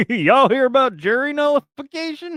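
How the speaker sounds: put-on loud, high-pitched Southern accent. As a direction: belt it out with a playful Southern accent. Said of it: "gave you a Southern accent".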